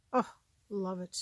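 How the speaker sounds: ordinary talk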